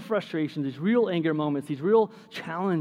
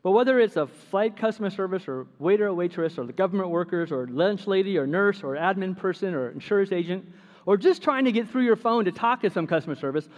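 ordinary talk, nothing marked

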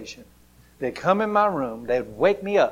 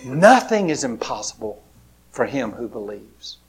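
ordinary talk, nothing marked

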